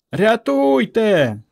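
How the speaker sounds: long, high wail for rescue; cry for help. A narrator's reading. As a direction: scream for help, stretching it out slowly. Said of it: "не вгавала Варка".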